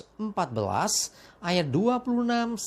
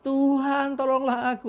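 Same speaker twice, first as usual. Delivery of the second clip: mild cry for help; 6 semitones higher than usual. Retold or imitated. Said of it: "dia berteriak".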